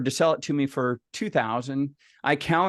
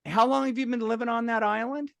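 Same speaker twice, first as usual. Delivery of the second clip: much higher, innocent-sounding query; leading question; 10 semitones higher than usual. Retold or imitated. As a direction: ask it like a brother setting up a pitch. Brother leads him toward the pitch.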